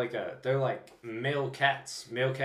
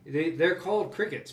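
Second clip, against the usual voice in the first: ordinary talk, nothing marked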